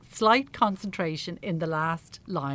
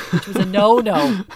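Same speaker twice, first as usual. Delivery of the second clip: laughing; 5 semitones higher, 13 dB louder than usual. Real, not acted. Laughing at the memory.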